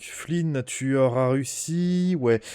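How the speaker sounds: ordinary talk, nothing marked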